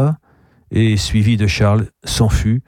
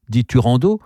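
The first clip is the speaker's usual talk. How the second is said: ordinary delivery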